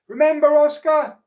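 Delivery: very high, loud called reminder; calling from the boat. Acted. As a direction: call back from the leaving boat. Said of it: "he called".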